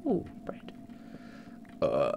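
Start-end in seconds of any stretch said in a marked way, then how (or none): 0.0-0.7 whispered ooh. Real, not acted. Delighted at finding bread.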